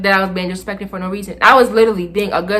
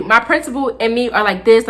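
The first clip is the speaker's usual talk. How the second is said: ordinary talk, nothing marked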